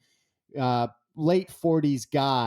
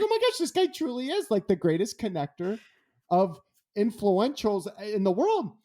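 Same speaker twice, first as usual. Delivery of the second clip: high, fast, amazed gush; gushing in disbelief; 8 semitones higher than usual. Retold or imitated. Speaker's amazed reaction.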